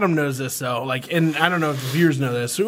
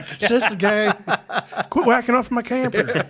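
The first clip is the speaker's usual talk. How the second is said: mock Southern accent; 7 semitones higher than usual. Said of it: "got a southern accent all of a sudden".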